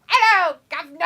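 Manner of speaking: high, loud Hello, governor with a laugh; cockney greeting, laughing; mock London accent. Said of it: "you say it just like that".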